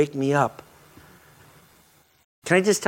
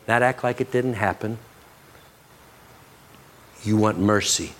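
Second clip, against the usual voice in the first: retold tender words; 3 semitones lower than usual. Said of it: "he spoke very tenderly to us".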